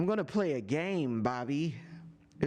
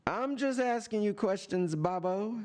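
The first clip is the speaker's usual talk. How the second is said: higher, sing-song innocent tease; mock-innocent taunt; 7 semitones higher than usual. Real, not acted. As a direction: play innocent to needle him. Repeated line, taunting higher.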